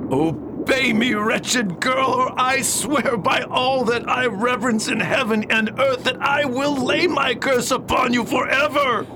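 high, shouted threat building; furious curse. Acted. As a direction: swear the curse in rage. Father threatens to curse daughter.

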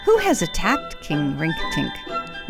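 ordinary talk, nothing marked

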